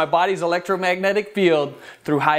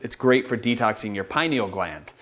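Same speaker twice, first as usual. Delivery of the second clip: usual speaking voice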